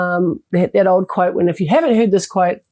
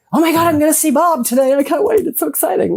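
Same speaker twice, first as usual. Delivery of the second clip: high, fast, gushing with excitement; excited gush; 7 semitones higher, faster than usual. Retold or imitated. Speaker imitating someone thrilled to see Bob, high and fast. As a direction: gush like you can't wait to see a friend.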